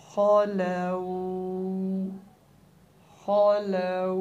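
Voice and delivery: खलौ drawn out with a long au ending; waqf form. Shown as a demonstration. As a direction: recite खलौ slowly. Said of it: "वक्फ".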